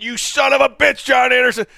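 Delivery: half-mock yell of recognition; drunken yelling. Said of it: "I started yelling at you".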